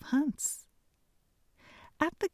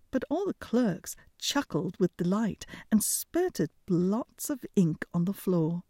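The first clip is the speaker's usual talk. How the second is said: usual speaking voice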